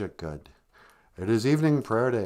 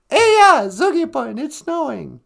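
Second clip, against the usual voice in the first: lively demo; 12 semitones higher, 5 dB louder than usual. Shown as a demonstration. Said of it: "You would say".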